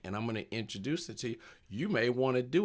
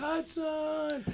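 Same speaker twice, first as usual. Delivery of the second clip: higher, drawn-out answer; kid's reply. Retold or imitated. Kid answering the crowd, in a higher drawn-out voice. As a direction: echo the name like a shy kid.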